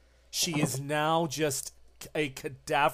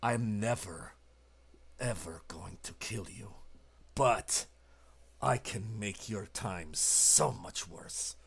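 low menacing threat; 7 semitones lower, 7 dB quieter than usual. Acted. Arturo holds the torch over her, low and menacing. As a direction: let the threat sink in, quiet and cold. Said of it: "he says".

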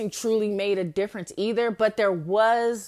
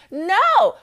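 high, loud outburst; indignant burst; emphatic refusal; 12 semitones higher, 11 dB louder than usual. Real, not acted. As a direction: shut the idea down hard.

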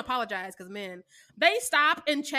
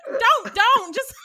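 pleading mock protest; 10 semitones higher, 7 dB louder, much slower than usual. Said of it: "I'm like".

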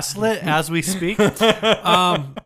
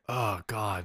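low, soft groan of dread; mock a weary wife, quietly; put-upon sigh of a line; exasperated wife; 6 semitones lower, 11 dB quieter than usual. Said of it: "she's gonna be like".

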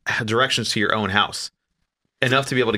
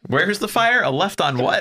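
mock confused firefighter; 9 semitones higher, slower than usual. Mocking firefighter dad.

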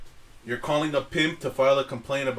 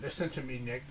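put-on Chinese restaurant accent; 4 semitones lower, 10 dB quieter than usual. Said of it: "Why do you sound like the guy at the Chinese restaurant?".

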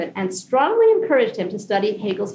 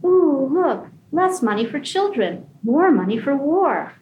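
higher, slow sing-song teacher voice; sing-song kindergarten teacher; 6 semitones higher, slower than usual. Retold or imitated. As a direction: parody a chirpy kindergarten teacher. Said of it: "in a sing-song parody kindergarten teacher voice".

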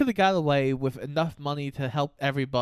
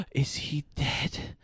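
gasping whisper; 5 dB quieter, slower than usual. Retold or imitated.

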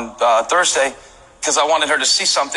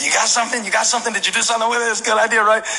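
eager asking; 8 semitones higher, faster than usual. Retold or imitated. Pastor pestering Wade about song.

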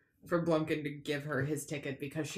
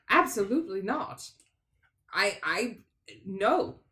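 indignant refusal; 3 semitones higher, 6 dB louder than usual. Acted. Character refuses to hand over symbol.

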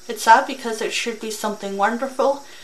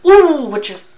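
emotional outburst; 5 semitones higher, 13 dB louder than usual. Real, not acted. Reactor overcome with emotion.

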